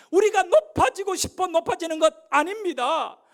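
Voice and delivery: loud, high, impassioned sermon delivery; full-force preaching. Acted. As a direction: preach it out loud to the whole church. (힘차게 외치는 설교조)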